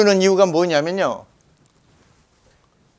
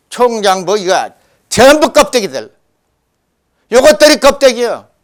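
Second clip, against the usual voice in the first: real, louder scornful denunciation; 거침없는 질타; 4 semitones higher, 6 dB louder than usual. Real, not acted. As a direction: fire off at them, voice rising higher and louder. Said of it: "나 쏘는 김에 아주". (목소리를 높여 쏘아붙이는 질타)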